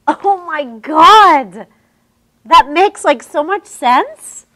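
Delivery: very loud, slow, high gush; overwhelmed fan gushing at her mentor. Acted. Student gushing at teacher.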